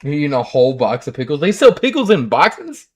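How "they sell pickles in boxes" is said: surprised. Real, not acted. Hearing about pickles in boxes.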